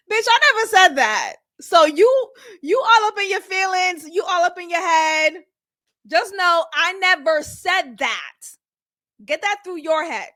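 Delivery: loud, high, sassy shutdown; sassy Seema shout. Retold or imitated. Seema checking Carrie, loud and sassy. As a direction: put her in her place, loudly. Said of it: "I like a Seema type of energy".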